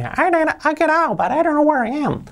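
very high, squeaky little-girl imitation; Lisa's little voice. Lisa calling from somewhere underneath, tiny voice. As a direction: squeak it out far above your own pitch. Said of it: "Eso dice allí con su vocecilla".

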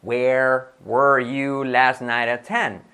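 Where were you last night at ten? said at half speed, each word apart; slow classroom demo; very slow model sentence. Shown as a demonstration. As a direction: spell it out slowly for learners. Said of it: "Tachán".

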